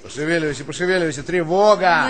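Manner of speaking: rising to a loud shouted alarm; urgent command. Acted. Colonel shouting at the dawdling soldier.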